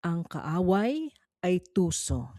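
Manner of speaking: ordinary talk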